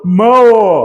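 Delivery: mão ending in a literal O, "mã-o"; drawn-out wrong mão with a full final O; mispronounced mão. Shown as a demonstration. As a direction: say mão with the written O sounded out. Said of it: "Porque eu não falo mão".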